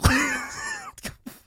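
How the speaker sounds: loud high cackle at the memory; cracking up remembering the brother's prank; burst of laughter. Real, not acted. Laughing hard at the prank text his brother sent.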